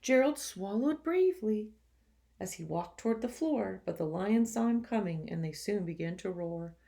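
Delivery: lively, expressive storybook reading; reading to children. A narrator's reading. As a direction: read it to the class with energy. Teacher reads picture book aloud.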